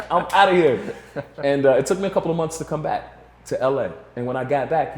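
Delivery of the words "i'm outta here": laughing relief. Retold or imitated. Leaving LA on the plane.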